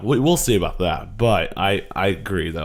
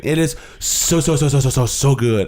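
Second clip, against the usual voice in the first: excited gushing; 5 semitones higher, 5 dB louder than usual. Real, not acted. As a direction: gush about it, rising. Gushing about the movie.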